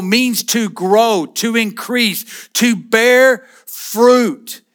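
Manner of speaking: slow definition. Real, not acted. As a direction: lay each meaning out slowly. Preacher defining word fruitful.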